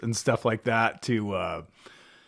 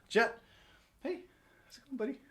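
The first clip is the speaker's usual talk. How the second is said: pet talk; 12 semitones higher, 10 dB quieter, much faster than usual. Real, not acted. Talking to the dog.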